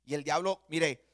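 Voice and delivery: normal talking voice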